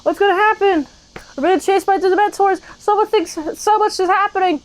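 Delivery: mock panic. Voicing the kids fleeing Dementors, mock frantic. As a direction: act out the Dementor chase in a frantic voice. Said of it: "and it's like".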